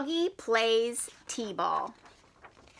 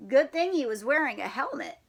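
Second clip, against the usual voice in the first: ordinary delivery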